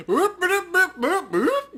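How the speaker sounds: high, loud mock siren whoops; gleeful whoop. Player pocketing the necklace, high gleeful whoops.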